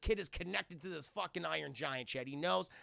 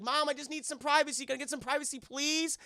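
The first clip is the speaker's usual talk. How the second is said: higher, pleading whine for privacy; exasperated pleading; 9 semitones higher, 4 dB louder than usual. Retold or imitated. Son begging mom through the door, exasperated pleading. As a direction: plead as an embarrassed kid.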